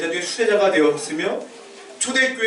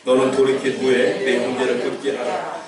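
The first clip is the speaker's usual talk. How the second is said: low, measured congregational Bible reading; scripture reading aloud; 4 semitones lower than usual. Real, not acted. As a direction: read the verse out evenly, leading the congregation. (낮고 고르게 성경 봉독)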